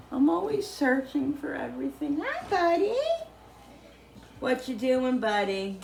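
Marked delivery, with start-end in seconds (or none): 2.2-5.8 high sweet pet voice; talking to a pet. Real, not acted. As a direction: coo at the dog. Greets the dog.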